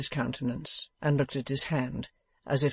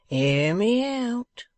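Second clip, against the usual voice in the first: higher and very slow, stretched out; drawn-out stalling; 9 semitones higher, 4 dB louder, much slower than usual. A narrator's reading. Wegg stalling Venus, slow and high. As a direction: drag it out to stall.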